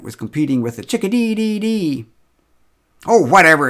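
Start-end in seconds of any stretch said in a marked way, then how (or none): 3.1-3.7 loud, dismissive outburst; loud impatient whatever; exclaimed. A narrator's reading. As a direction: brush it all off, loudly. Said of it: "exclaimed Marcia".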